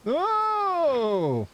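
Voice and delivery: high startled yelp; sudden shock at the game. Real, not acted. Streamer yelps at game mishap, startled.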